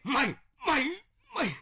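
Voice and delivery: high, loud furious stammer; sputtering rage. Acted. Father sputtering at his rebellious son, enraged. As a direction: choke on the anger.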